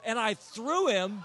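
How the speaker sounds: normal talking voice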